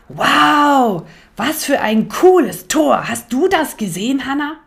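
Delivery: hoher, lauter Jungenjubel; begeistert. Acted. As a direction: wie ein kleiner Junge, der sich über ein Tor freut. Leo begeistert vom Fußball. (Leo ruft seiner Schwester begeistert zu, hoch und laut)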